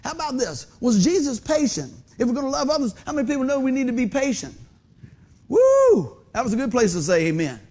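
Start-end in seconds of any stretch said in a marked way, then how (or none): 5.5-6.2 high, loud "woo"; whoop. Real, not acted. Preacher whooping at his congregation after a hard point, high and loud. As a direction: let out a high held whoop. Reacts to congregation's silence on patience.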